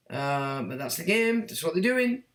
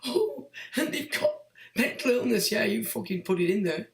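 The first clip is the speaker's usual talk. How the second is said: mocking falsetto whine; 3 semitones higher than usual. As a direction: squeak out the complaint to ridicule it. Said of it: "listening to people".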